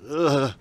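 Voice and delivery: loud gagging ugh; disgusted groan. Acted. Nauseous from the organs, a loud disgusted ugh. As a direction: retch at the smell.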